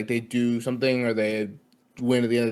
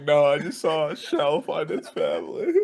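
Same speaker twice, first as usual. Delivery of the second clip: words half laughed, half teary; laughing through it; 3 semitones higher than usual. Real, not acted. Telling friend about crying over the shell, laughing. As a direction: giggle through the line as if near tears. Laughing at own library tears.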